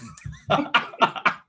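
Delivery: laugh. Real, not acted. Game master reacts to player's telepathic insult, laughing. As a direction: burst of high laughter.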